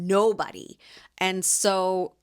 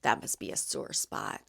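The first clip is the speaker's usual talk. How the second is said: hushed sinister whisper; a low, unvoiced, sinister old lady's whisper; 10 semitones lower, 9 dB quieter than usual. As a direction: breathe it out like a nasty secret. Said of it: "looked up at me all sinister-like".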